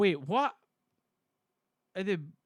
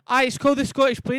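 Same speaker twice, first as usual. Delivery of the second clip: fast, loud, higher-pitched comeback; exasperated retort; 6 semitones higher, 9 dB louder than usual. Real, not acted. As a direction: fire back impatiently. Arguing back at chat's claim.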